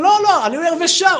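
high, loud protest; mock emphatic denial; acted insistence. Retold rabbi insisting.

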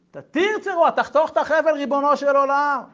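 high, loud cry to God; prayer cry. Acted. Preacher crying out to God to cut the rope, loud prayer. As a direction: cry out the prayer with full force.

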